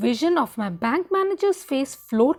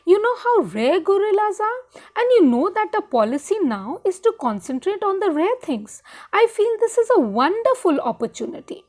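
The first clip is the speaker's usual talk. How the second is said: eager persuading; 7 semitones higher than usual. Acted. Husband talking up the deal.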